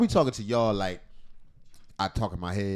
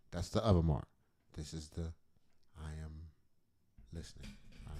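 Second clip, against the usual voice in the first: normal talking voice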